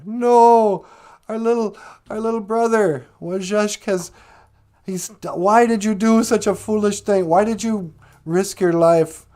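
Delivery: high, loud wailing grief; crying lament. Acted. The boys mourn their drowned little brother, wailing and crying. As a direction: wail it out over the lost brother. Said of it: "they started to cry".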